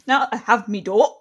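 put-on Zayn's accent. Podcast host mimicking a reality star, mock British accent. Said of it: "Zayn's accent, the way he says".

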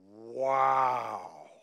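a low, awed wow; hushed amazement. Shown as a demonstration. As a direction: let the wonder out in one word. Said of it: "you will most certainly go".

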